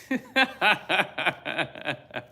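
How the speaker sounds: loud, high burst of laughter; real laugh. Real, not acted. Preacher laughing at his own devotional, amused. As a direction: break up laughing.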